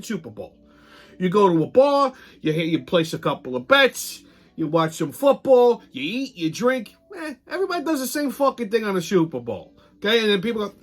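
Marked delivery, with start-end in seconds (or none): none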